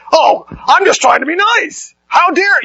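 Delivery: hammed-up offended huff; imitating a prickly comeback, high and drawn out; indignant retort. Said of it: "responded with that kind of antagonism".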